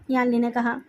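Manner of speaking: ordinary delivery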